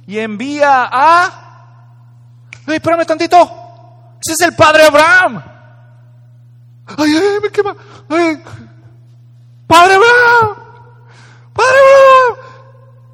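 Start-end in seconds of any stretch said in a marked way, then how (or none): 2.7-8.4 high, loud pained cries of burning; rich man in torment. Acted. 9.7-13.1 very high, loud, slow drawn-out cry; desperate shout. Acted. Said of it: "y gritando dijo".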